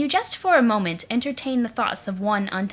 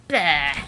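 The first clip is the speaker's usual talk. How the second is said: disgusted bleh; 4 semitones lower, 4 dB louder than usual. Acted. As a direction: spit out a loud disgusted bleh. Tastes troll blood, disgusted.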